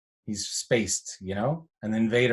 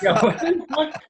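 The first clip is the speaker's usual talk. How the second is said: very high, loud incredulous squeal; re-enacted high-pitched disbelief; mock bewilderment; 6 dB louder than usual. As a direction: squeal it through a laugh. Acting out bewilderment at the praise.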